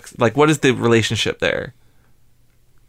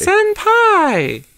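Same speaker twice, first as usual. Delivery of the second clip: Senpai squealed very high and loud; 5 dB louder than usual. Shown as a demonstration. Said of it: "Maybe if I change the inflection".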